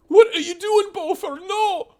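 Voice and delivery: high, loud frantic scream. Acted. Mother screaming at her son to keep away from the body. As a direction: shriek to stop him.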